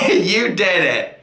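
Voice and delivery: slow, laughing self-praise. Real, not acted. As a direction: savor it, laughing at yourself.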